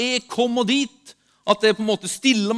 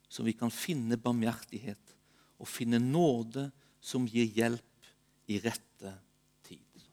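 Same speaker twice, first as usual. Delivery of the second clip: solemn scripture reading; 6 semitones lower than usual. Real, not acted. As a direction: read scripture deliberately, settling into a hush. Reads a Bible passage aloud.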